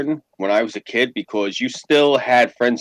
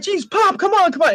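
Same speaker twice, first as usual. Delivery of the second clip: pleading son. Retold or imitated. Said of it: "and I'm like".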